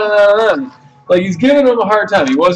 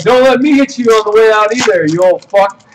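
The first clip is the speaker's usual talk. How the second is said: raised, forceful re-enacted threat; retold angry comeback; 4 semitones higher, 4 dB louder than usual. Customer snapping back at the old man, re-enacted anger. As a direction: fire back at him, higher and emphatic.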